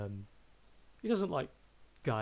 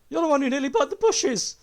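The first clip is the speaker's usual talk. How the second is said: much higher, much louder pointing accusation; accusing shout; 15 dB louder than usual. Acted. NPC points at the healer and shouts the accusation. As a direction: yell it out like you caught the culprit. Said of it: "immediately points at you and goes".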